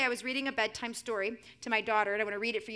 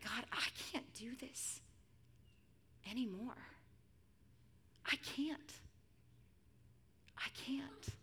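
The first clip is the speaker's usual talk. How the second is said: soft, halting plea to God; acted exhaustion, with long pauses; quiet despair, rising on the repeats; desperate surrender; 11 dB quieter, slower than usual. Voicing a believer at breaking point.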